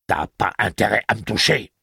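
high, loud street-tough woman; shrill defiant threat. A narrator's reading. Detta warns the gunslinger off, high and loud. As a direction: snarl it at him, scared but spitting.

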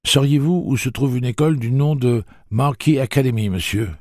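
put-on high polite boyish question; boy's voice. Jake inventing a question for the old man, boyish. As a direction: ask brightly like a schoolboy.

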